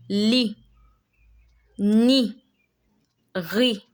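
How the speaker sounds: "li, ni, ri." each ending on a French ee; silent final consonants. Shown as a demonstration. Teacher reads example words to learners, careful French pronunciation. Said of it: "You don't pronounce the T".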